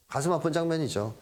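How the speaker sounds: ordinary talk, nothing marked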